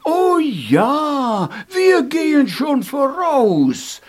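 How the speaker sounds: high, loud, eager voice; eager immortals. Acted. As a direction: answer brightly and eagerly. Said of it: "sagten die Unsterblichen".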